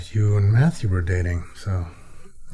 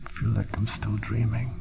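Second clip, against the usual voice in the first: whispering; 7 dB quieter than usual. Acted. Dreamy morning murmur.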